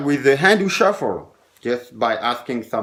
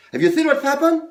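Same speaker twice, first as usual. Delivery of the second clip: much higher questioning patter; showman patter; 13 semitones higher, faster than usual. Shown as a demonstration. Magician turning to another spectator, high patter. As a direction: sing it up high to a new spectator. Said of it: "I look at somebody else here".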